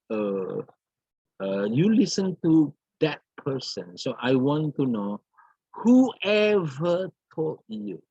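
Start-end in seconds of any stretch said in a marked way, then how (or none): 5.7-8.0 WHOEVER drawn out slowly and pitched high; slow, high stress on WHOEVER; disbelief. Shown as a demonstration.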